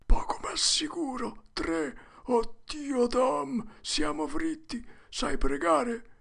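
put-on high, whimpering, terrified boy; shuddering whisper. A narrator's reading. Huck takes the men for devils, shuddering whisper. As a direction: whisper in dread, stretching the last words. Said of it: "Huckleberry sussurrò con un brivido".

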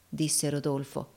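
plain reading voice

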